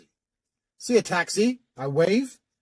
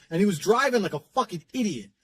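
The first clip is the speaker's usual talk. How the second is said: usual speaking voice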